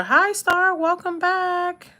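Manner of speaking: high, bright-eyed hello to a viewer; excited greeting. Real, not acted.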